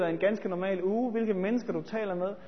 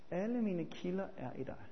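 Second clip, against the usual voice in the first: much softer, let it sink in; hushed repeat; 3 semitones lower, 12 dB quieter than usual. Shown as a demonstration. Preacher repeating the psalm line softly to the congregation. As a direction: say it again, near a murmur. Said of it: "Salme syvogfirs siger David".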